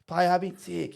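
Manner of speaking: lightly squeaky coach impression; imitating the coach. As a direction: tick boxes as a comic coach. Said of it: "He goes".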